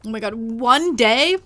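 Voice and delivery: ordinary talk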